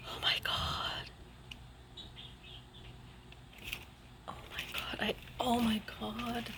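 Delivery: very soft, hushed amazement; real breath-taken disbelief; stunned whisper. Real, not acted. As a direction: whisper it in awe, barely voiced. Stunned by the watercolors.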